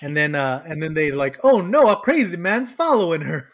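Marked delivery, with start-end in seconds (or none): 1.4-3.5 high, loud, hammed-up alarm; badly acted old couple. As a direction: overdo the swoops and stretch the last words. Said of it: "terrible they were at acting".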